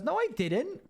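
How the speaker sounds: mock the dad's excuse in a high voice; imitating dad. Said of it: "And I goes".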